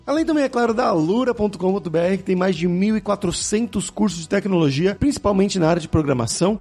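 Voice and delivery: lively, projected host sign-off and sponsor plug; podcast ad read. Acted. Podcast host plugs sponsor courses to listeners, upbeat ad read. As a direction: sell it with punchy, swooping announcer energy.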